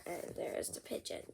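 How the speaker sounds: whispered aside. Real, not acted. Quiet aside.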